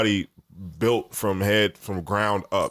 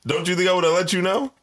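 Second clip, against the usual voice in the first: heated laughing protest; 12 semitones higher, 4 dB louder than usual. Real, not acted. Heated jokey argument with cohost.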